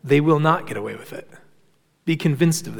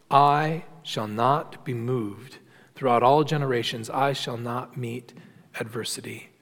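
smug inner boast; 6 semitones lower, 7 dB quieter than usual. Retold or imitated. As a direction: let the wicked man gloat quietly to himself. The wicked's boast voiced aloud.

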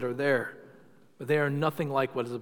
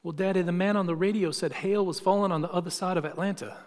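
higher-pitched imitation of a little Southern girl; sweet Southern girl; 4 semitones higher than usual. Little girl explains to her daddy, sweet Southern accent. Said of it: "in her sweet little southern voice".

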